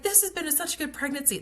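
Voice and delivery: high, strained lament; retelling her upset at losing a good pregnancy; distraught disbelief. Retold or imitated. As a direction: wail that it was all going so well. Disbelief at sudden emergency.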